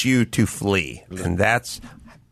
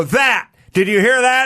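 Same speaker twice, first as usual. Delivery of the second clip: louder, higher "did you hear that"; excited retold; 11 semitones higher, 4 dB louder than usual. Host retelling his call, mock triumphant. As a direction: point it out triumphantly. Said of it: "I'd go".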